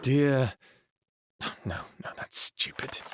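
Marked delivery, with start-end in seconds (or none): 1.6-3.0 whisper. Acted. Whispered self-correction.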